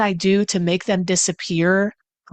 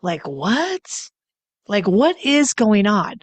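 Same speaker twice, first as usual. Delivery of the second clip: confused; 2 semitones higher, much slower than usual. Retold or imitated. Someone confused by a partner's behavior, puzzled and slow. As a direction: sound baffled, scratching your head. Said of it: "scratching your head like".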